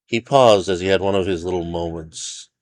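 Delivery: ordinary delivery, nothing marked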